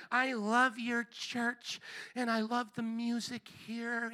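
slow, drawn-out, softened put-on voice; mock offended churchgoer. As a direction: mimic a reluctant complainer, stretching the words. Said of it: "this is how he sounded".

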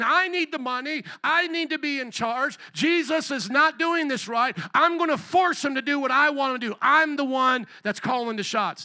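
self-important, high and loud insistence; Judas. Acted. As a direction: play a man demanding control, pitched high. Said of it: "you see Judas being the one that's self-adorning".

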